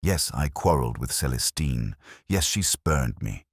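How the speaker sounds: ordinary delivery, nothing marked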